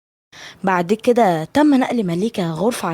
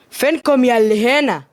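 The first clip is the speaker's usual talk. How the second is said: higher, raised call into the house; 5 semitones higher, 4 dB louder than usual. A narrator's reading. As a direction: call out to whoever is home. Arriving man calls out to household.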